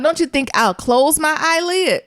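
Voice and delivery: ordinary delivery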